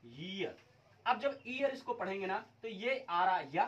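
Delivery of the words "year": 'ईयर' slowly, with a y in front, like 'year'; correct 'y' onset. Shown as a demonstration. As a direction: draw out 'ईयर' as 'yee-ar'. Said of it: "इसको पढ़ा जाता है".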